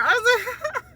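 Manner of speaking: high, soft laughing voice; laughing through tears. Real, not acted. Mother recalling birth, laughing through tears. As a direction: laugh it out overwhelmed. I'm hysterical.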